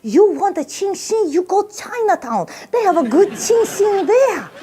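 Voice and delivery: high, slow mock accent, starting hushed and building; secretive Chinese waiter. Waiter sends a customer off to Chinatown, high conspiratorial mock accent. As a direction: confide it in a hammed-up Chinese accent, drawn out. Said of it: "The guy goes".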